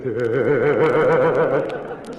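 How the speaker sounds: a hammed-up laugh from the back row; schoolboy's giggle. Second boy in the back laughs at the teacher. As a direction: giggle like a naughty kid.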